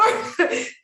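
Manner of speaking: breathy high laugh; airy happy laugh; delighted laugh. Real, not acted. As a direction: laugh with joy. Speaker reacts to praise.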